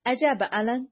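plain reading voice

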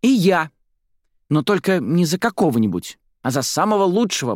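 put-on very high, keen little-girl voice; high, emphatic childish chime-in; eager child chiming in. A narrator's reading. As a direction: jump in eagerly like a kid. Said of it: "подхватила Ла Сомбра".

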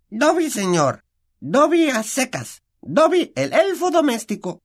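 a tiny servant overjoyed to meet his hero; house-elf Dobby. A narrator's reading.

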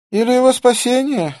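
usual speaking voice

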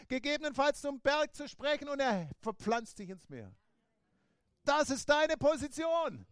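loud, high, driving sermon; full-force preaching. Acted. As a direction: preach it to the rafters.

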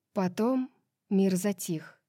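ordinary delivery, nothing marked

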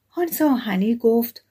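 plain reading voice

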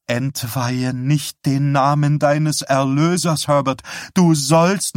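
high, insistent admonishing; pious rebuke. A narrator's reading. Wife rebuking her husband for blasphemy, pious scolding.

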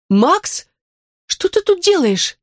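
high startled question; surprised. Acted. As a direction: caught off guard, rising. Startled by her best friend. (удивлённо)

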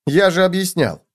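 high-pitched gopher voice; protesting gopher. A narrator's reading. Gopher reminds cockroach he explained it, protesting.

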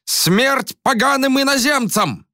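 high piercing shriek; shrill scream. A narrator's reading. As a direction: scream it at the top of the range. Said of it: "это визжал Боре".

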